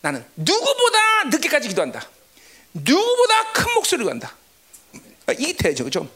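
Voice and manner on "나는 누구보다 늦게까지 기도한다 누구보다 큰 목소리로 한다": high, emphatic vow; proudly insistent, pitched up; competitive resolve. Retold or imitated. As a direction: declare it like a boastful challenge. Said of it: "내가 처음에 그랬어요".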